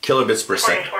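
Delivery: ordinary delivery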